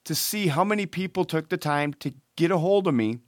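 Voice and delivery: ordinary talk, nothing marked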